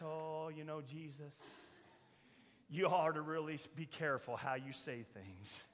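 tut-tut at the preacher like a timid church member; mock cautious churchgoer. Preacher mocking a churchgoer who scolds Jesus, in a soft prissy voice.

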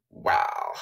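very low, creaky mock amazement; put-on low "wow"; Kim. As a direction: imitate a celebrity's unimpressed drawl. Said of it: "I'm just gonna talk like Kim".

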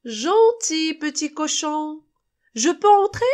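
loud, high wheedle from the wolf; coaxing wolf. A narrator's reading. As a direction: call sweet-talk through the door. Wolf coaxing at the second house.

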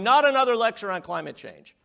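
hammed-up audience complaint; imitating fed-up listeners fleeing a lecture; mock exasperated groan. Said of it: "everybody runs screaming out of the room going".

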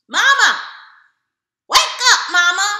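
high, loud call to wake her. Retold or imitated. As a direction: shout to wake up mama. Calling mother on phone at night.

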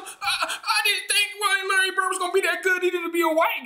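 imitating Shannon Sharpe. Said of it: "He told us some".

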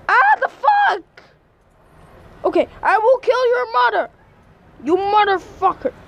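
furious high yelling at the game; angry gamer rage. Real, not acted. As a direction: rage at the enemy, loud and high. Raging at enemy in game.

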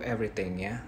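ordinary talk, nothing marked

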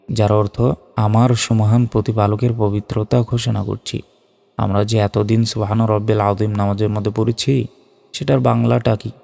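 ordinary delivery

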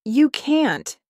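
high, urgent objection; urgent refusal. A narrator's reading. Goat stops the sheep from peeing, urgent. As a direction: forbid it urgently, pitch jumping up.

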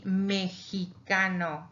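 "mexicano" drawn out slowly as me-hee-KA-no. Shown as a demonstration. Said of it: "Mexico is like a J".

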